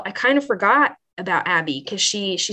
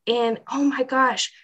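ordinary talk

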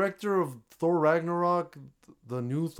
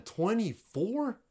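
drawn-out, high rising disbelief; incredulous; 7 semitones higher, much slower than usual. Real, not acted. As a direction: stretch it into a stunned question. Dismayed at the long cast list.

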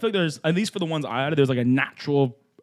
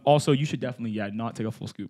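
ordinary talk, nothing marked

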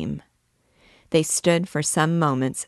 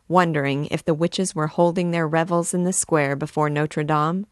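plain reading voice

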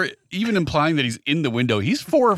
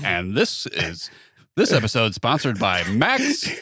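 slow, low sponsor announcement; ad read voice; 4 semitones lower, slower than usual. Pretend. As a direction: read it like a radio ad.

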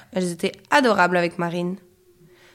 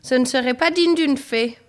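scolding mother; 4 semitones higher, 4 dB louder than usual. A narrator's reading. Mother scolds fairy daughter.

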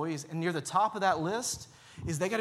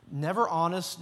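ordinary delivery

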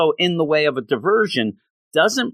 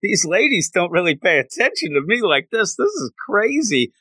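higher-pitched, giddy retold thought; excited; 6 semitones higher than usual. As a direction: act out his amazed excitement. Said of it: "then he gets so excited".

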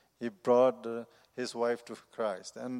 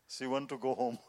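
ordinary talk

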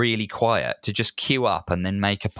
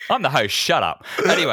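higher, bossy mock diva; 7 semitones higher, 3 dB louder than usual. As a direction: play the self-important host diva. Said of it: "The true [name] comes out".

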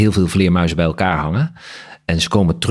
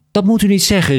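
much higher voiced protest; crying out; 14 semitones higher than usual. A narrator's reading. Daughter-in-law protesting the father's talk of dying, crying out. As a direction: cry out in protest, pitched high. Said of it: "riep ze".

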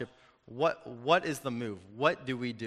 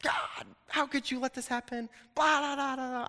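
high-pitched mock railing at God; retold angry rant; 11 semitones higher than usual. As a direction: imitate yourself going off in a high, strained voice. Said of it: "I was letting him have it".

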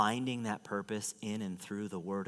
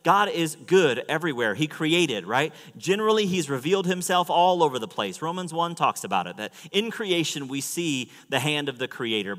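high, loud, driving sermon voice; impassioned preaching; 8 semitones higher, 10 dB louder than usual. Real, not acted. Preacher proclaims God's revelation to the congregation, impassioned preaching. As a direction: preach it out with full force.

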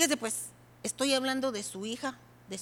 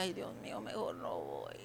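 unvoiced, much lower, scared whisper; frightened whisper; 12 dB quieter than usual. Preacher retelling her fear before preaching, whispering. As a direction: whisper it like you want to back out. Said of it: "Que decía".

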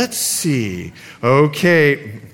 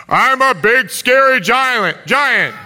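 slow, raised-pitch pretend giant; big scary giant; 6 semitones higher, slower than usual. Preacher as giant challenging little Caleb, slow mock menace. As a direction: stretch the menace out playfully. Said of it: "I'm gonna be the big, scary giants".

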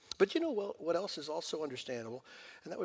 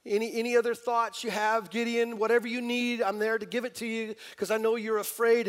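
loud generous God; 7 semitones higher, 9 dB louder than usual. Retold or imitated.

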